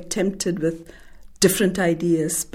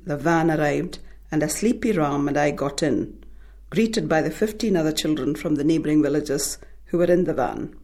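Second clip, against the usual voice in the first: ordinary delivery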